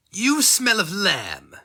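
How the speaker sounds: high, slow, sneering voice; mocking stranger. A narrator's reading. Stranger leaning over the burning man, high slow taunt. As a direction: savour each word like a taunt. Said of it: "he says".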